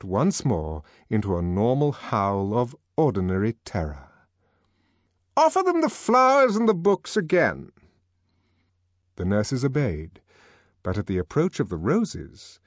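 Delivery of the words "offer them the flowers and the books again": high-pitched order to staff; commanding director. Acted.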